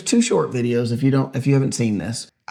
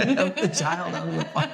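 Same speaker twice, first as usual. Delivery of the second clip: higher, softening into laughter; laughing mock outrage; 5 semitones higher, 4 dB quieter than usual. Repeating the co-host's mock commenter plea, laughing. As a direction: echo the commenter's plea, barely holding the laugh. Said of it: "my favorites are the ones that are like".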